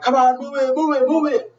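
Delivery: high, urgent rallying yell; acted-out action-hero yell; urgent command. Rambo hurrying prisoners to helicopter.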